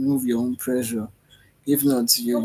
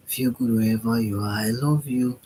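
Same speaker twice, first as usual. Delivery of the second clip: ordinary delivery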